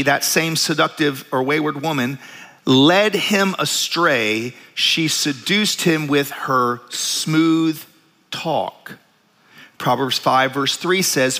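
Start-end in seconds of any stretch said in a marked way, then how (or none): 2.7-9.0 scripture reading. Acted. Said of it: "reading Proverbs aloud".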